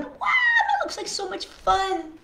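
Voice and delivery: high voice of a small mouse longing; wistful little boy. Acted. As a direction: breathe it like a wishful child. Reader voicing Henry's longing.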